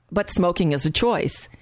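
ordinary talk, nothing marked

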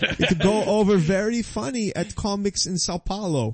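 put-on high-pitched Brazilian fighter's accent; mock Brazilian accent. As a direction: imitate a Brazilian fighter politely thanking people in broken English. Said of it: "and they talk like this".

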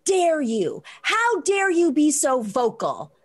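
woman with her cart scolding the speaker, mock indignant and slow. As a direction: stretch out the outrage like an offended shopper.

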